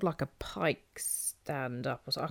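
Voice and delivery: whispered unsure aside; whispering. Real, not acted. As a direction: drop to a whisper. Unsure aside while explaining.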